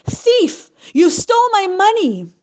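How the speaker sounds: high, loud cry of thief; furious widow yelling at the thief; accusing shout. Acted. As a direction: scream the accusation at him. Widow accuses Isaac of theft.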